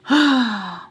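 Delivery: big exasperated sigh; heavy sigh out loud. Real, not acted. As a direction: let out a loud weary sigh. Sigh after giggling at herself.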